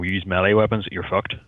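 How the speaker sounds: usual speaking voice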